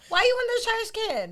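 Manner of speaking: scolding the kitten. Retold or imitated. Owner finding her kitten in the trash, high playful scolding. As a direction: tell off a kitten with love. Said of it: "like my kitten".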